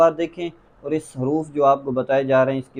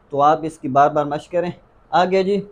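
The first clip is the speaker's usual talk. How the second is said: ordinary talk, nothing marked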